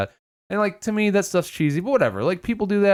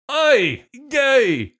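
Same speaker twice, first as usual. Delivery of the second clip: put-on high, loud failed Scottish accent; hammed-up fake Scottish parent; bad Scottish accent; 11 semitones higher, 5 dB louder than usual. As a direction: badly attempt Scottish, pitched way up. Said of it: "Can't do Scottish".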